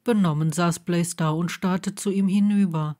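usual speaking voice

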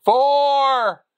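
high, loud call of the number; excited shout. Real, not acted. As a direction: yell out the roll, high. Calling the needed roll.

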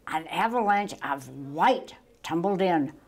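ordinary delivery, nothing marked

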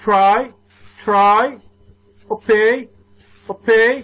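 Buy, wash, cry, obey each called out with a rising end; read-again word drill. Shown as a demonstration. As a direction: model each word for pupils to repeat, isolated, lifted at the end. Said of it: "Let's read again".

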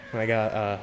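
ordinary delivery

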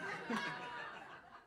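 unvoiced-sounding breathy laugh. Real, not acted. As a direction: laugh at your own cheeky question. Laughs after the forbidden question.

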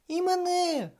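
put-on very high little-girl cry; eager exclamation. A narrator's reading. Vroni begging to ride too, high eager cry. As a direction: pipe up very high. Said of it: "вигукнула Вроні".

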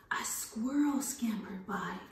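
hushed whisper for the squirrel. Acted. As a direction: whisper it like a secret. Rhyme for children, whispered.

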